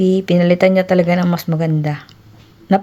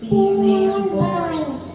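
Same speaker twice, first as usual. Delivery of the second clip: put-on very high, slow baby voice; squeaky-high and drawn out; 11 semitones higher, much slower than usual. Shown as a demonstration. Testing the mic's baby setting, very high voice. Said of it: "Baby voice".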